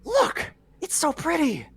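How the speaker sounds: put-on high sweet child voice; little girl voice. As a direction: sound like a delighted small child. Child character.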